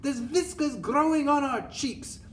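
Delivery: high, loud, horrified exclamation; hammed-up high-pitched shout; child's shout. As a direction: squeal it like an appalled child. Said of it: "Another shouts".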